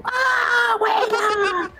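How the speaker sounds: very high shouted fan cheer; crowd cheering. Retold or imitated. Imitating the ringside crowd catching his sweat, high shouted cheer. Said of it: "Y el público".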